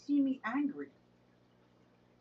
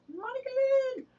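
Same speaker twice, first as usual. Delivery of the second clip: excited call-out; 5 dB louder, faster than usual. Real, not acted. Calling out a viewer's name.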